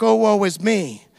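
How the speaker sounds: mock woe is me. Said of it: "if I walk like this".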